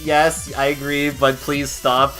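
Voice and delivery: mock exasperated plea. Streamer answering trash-talking chatters, mock exasperated plea. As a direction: give in, then beg in playful exasperation.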